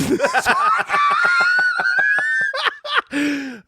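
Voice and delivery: shrieking high laugh. Real, not acted. Explosive laugh at ridiculous idea.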